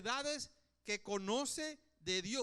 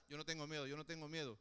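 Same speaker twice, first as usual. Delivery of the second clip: low, soft muttered mantra; mock self-reassurance; 6 semitones lower, 6 dB quieter, much faster than usual. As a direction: repeat it under your breath to convince yourself. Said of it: "no te empieces a decir en la cabecita". (murmullo bajo y suave, como mantra)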